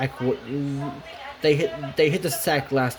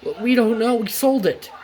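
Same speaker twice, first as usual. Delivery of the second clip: put-on higher stammering child voice; nervous kids; 8 semitones higher than usual. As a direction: answer an angry engine as a nervous kid. Scared kids answer angry Thomas.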